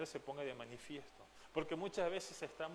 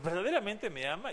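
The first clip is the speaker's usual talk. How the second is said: louder, pressing question; the Lord asking Pedro again, louder and insistent; insistent third asking; 5 semitones higher, 7 dB louder than usual. Retold or imitated. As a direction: ask it a third time, pushing harder. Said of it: "le pregunta por tercera vez".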